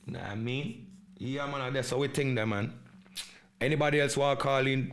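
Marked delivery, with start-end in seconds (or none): 3.6-4.9 louder, quick call to the audience; rally the listeners to phone in; host hyping the hotline, loud and fast; calling out to viewers. Real, not acted.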